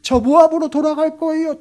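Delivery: very high, loud falsetto whimper; daughter-in-law pleading with her mother-in-law in mock tears; mock tearful plea. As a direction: whine it like a teary daughter-in-law. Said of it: "눈물요".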